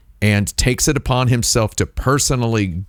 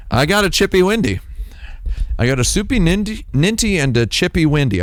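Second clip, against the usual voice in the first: put-on baby talk; 7 semitones higher, faster than usual. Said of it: "playful baby-talk about the windscreen".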